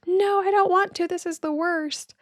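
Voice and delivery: put-on high bratty kid wail, dragging out the worst; inner child tantrum. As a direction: play your inner child stamping its feet and refusing. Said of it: "throwing a temper tantrum and saying".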